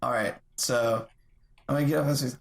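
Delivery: normal talking voice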